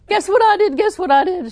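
high, loud giddy excitement; bursting to share good news; excited. Retold or imitated. Little lady telling the pastor her news, excited. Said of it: "She was so excited".

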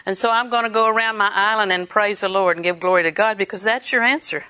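ordinary talk

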